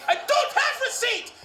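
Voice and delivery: high yelling clerk. Retold or imitated. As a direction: clerk squeals his refusal.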